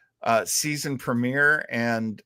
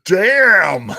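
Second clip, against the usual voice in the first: loud, higher burst of amazement; impressed exclamation; 6 semitones higher, 11 dB louder than usual. Real, not acted. Host amazed at co-host saying the name, loud exclamation. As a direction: blurt it out, genuinely floored.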